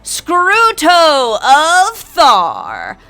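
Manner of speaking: loud, high, drawn-out ring announcement; referee's yell. Acted. Referee proclaiming the ogre victor, yelled. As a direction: bellow the winner's name slowly to the crowd. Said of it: "the referee yells".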